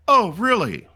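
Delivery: high, loud sarcastic oh really; mock the soldier's lie; hammed-up sarcastic disbelief; sarcastic commander. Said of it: "He was like".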